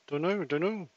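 warbly, suppressed mic sound; noise reduction artifacts. Real, not acted. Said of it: "Noise suppression is on".

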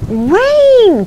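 high, loud alarmed cry. Acted. Ants crying out as the rain starts. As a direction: shout the warning at the sky. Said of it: "cried the ants".

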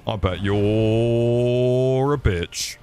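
slow, loud stretched-out taunt; drawn-out taunt. Pretend. Streamer teasing a viewer, slow loud mock taunt. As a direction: drag every word out to needle him.

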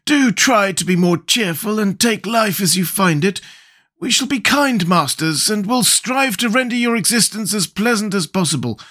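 kindly Scarecrow. A narrator's reading. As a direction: coax kindly in a high voice. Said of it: "said the Scarecrow kindly".

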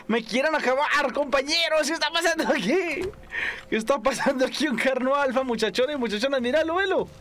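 high, frantic gamer panic; panicked excitement. Real, not acted. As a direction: yelp it out, half laughing. Atacado por carno alfa. (pánico agudo y frenético)

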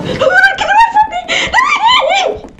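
very high, drawn-out squeal; giggling, squealed protest; laughing shriek. Real, not acted. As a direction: shriek through laughter as you flee. Chased in tag game.

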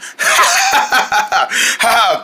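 long staccato cackle; drawn-out acted ha-ha-ha; mocking laugh. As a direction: laugh it out in a rapid run. Laughs at height joke.

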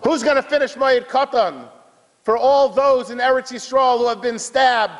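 high, fervent closing appeal; impassioned preaching. Real, not acted. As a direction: cry out the question to the congregation.

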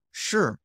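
short falling SHI; falling fourth tone. Shown as a demonstration. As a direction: say SHI high and let it fall fast. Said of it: "falling, fourth tone".

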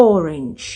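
"orange" as OR-inj, low and falling; "orange" closed on a soft J; English orange. Shown as a demonstration. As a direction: say "orange" with first-syllable stress. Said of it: "บ่แม่น orange จังสิเดะ".

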